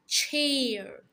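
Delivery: ordinary talk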